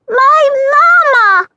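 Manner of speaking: put-on high, loud, drawn-out toddler shout. Toddler shouting for her brother, high slow and loud. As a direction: bellow it like a stubborn little child. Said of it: "Christina bellowed then".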